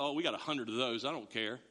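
low, offhand, quick shrug; mock-casual, dropping low and soft; acted indifference. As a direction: toss it off as no big deal. Acts not caring about breakage.

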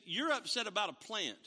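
high, slowing, swelling rebuke; God's rebuke. Retold or imitated. God rebuking Jonah over the plant, high and slow. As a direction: press Jonah slowly. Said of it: "He said".

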